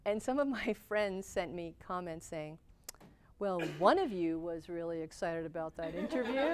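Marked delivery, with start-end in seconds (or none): 3.4-6.5 laughing. Real, not acted. Quoting friends' teasing comment.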